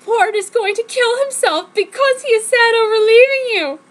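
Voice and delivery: high, loud hammed-up sobbing voice; mock crying. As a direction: wail it like a soap opera. Friend bursts into tears.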